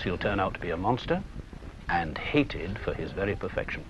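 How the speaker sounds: normal talking voice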